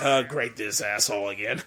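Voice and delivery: mildly annoyed grumble. Retold or imitated. Voicing a fed-up comic character, mildly annoyed. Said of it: "basically sounds annoyed as hell".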